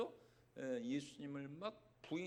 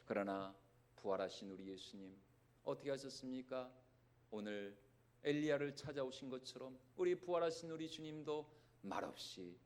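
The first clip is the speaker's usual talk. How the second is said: tender hush; 5 semitones lower, 5 dB quieter than usual. Real, not acted. Tender turn in the sermon.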